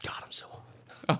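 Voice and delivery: worn-out whisper after ranting about his ex; overheated whisper. Real, not acted. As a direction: sigh it out under your breath, spent. Overheated after angry rant.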